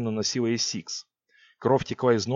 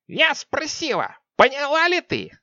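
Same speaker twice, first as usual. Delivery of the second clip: menacing witch; 4 dB louder, slower than usual. Acted. Witch looming over queen.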